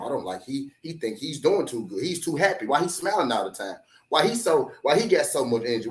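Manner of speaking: hater's low complaint, mockingly retold; mock hater grumbling. Voicing resentful haters complaining about him.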